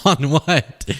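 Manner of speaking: laughing through the question; real giggle mid-question; giggling. Real, not acted. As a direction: giggle while asking. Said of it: "I'm so giggly today".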